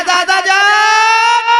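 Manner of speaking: high, loud and very slow call; drawn-out call. Retold or imitated. Amina answering the grandfather, a long loud call. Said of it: "मेरी बेटी आमना ने कहा".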